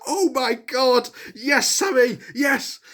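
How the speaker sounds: high, slow cheering yes; excited cheer. Retold or imitated. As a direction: relive the cheer for Sami. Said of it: "I was like".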